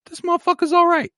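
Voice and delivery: mock sibling voice. Voicing sibling making peace.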